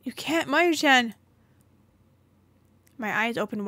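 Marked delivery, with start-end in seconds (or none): 0.0-1.1 higher, louder, slow stop shout; urgent shout to halt her; urgent warning. Acted. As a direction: throw yourself in the way with your voice. Man stops girl turning back.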